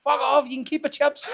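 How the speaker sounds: high, loud giggling give-in; laughing surrender. Retold or imitated. Giving up the chips to the witch, laughing high. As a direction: cave in while cracking up.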